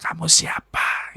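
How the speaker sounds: slow unvoiced whisper from something else; hush, then ask who he is, barely breathing; eerie jinn whisper. Jinn inside the woman whispering at the healer, slow eerie whisper.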